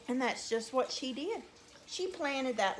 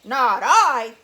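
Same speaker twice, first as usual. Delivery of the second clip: loud, barked gruff voice; gruff it out like a dog; 15 dB louder than usual. Shown as a demonstration. Storyteller showing children how a dog would say it, loud and gruff. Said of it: "He'd sort of gruff it".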